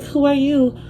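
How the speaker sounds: high mock comeback; imitated female caller. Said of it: "She's like".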